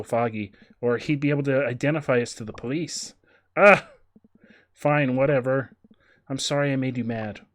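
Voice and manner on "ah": startled shout. Acted. Sudden outburst in game.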